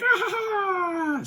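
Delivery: very high, put-on evil laugh; shrieky mock cackle; villainess cackle. Rita gloating over the destroyed city, high cackle.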